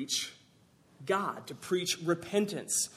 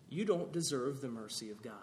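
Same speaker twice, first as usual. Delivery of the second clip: normal talking voice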